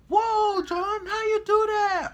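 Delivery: high loud falsetto, slow and wowed; mock amazed friends. Deborah and T J amazed at John upside down, high and loud. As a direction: gasp it out in amazement. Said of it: "they was like".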